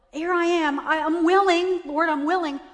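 loud, high pleading to God; retold anguished plea. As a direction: insist upward, offering yourself. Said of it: "I was really sad. And I was saying to myself".

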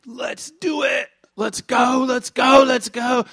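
building to a shout on the second "let's go"; rally the troops, rising excitement; eager rallying cry. Retold or imitated. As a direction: Joshua and Caleb urging everyone in. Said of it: "Joshua and Caleb are like".

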